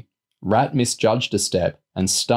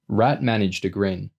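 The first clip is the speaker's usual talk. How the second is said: usual speaking voice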